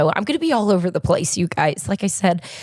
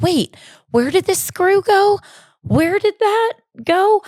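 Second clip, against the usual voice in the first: flustered self-quote; 12 semitones higher, 3 dB louder than usual. Retold or imitated. Retelling fixing the dryer alone.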